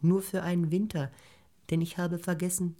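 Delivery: hohe, zaghafte Grillenstimme; höher und bittend sprechen, wie ein kleines Insekt; Grille bittet. Acted. (bittende Grille, hoch und leise)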